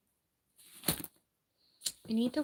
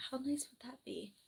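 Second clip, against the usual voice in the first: whisper; 4 semitones higher, 8 dB quieter than usual. Real, not acted. Dreaming aloud of a hot tub.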